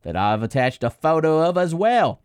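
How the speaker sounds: reading the fan's email as an Aussie, badly; bad Australian accent. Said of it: "butchered his, uh, homeland, his accent".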